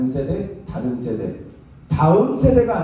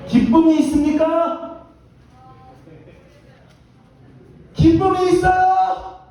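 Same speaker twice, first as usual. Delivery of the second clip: very high, stretched-out question; high, drawn-out challenge; 10 semitones higher, slower than usual. Real, not acted. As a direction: pitch it way up and drag it out at the crowd. Exaggerated challenge to the congregation. (높고 길게 늘여 외치는 질문)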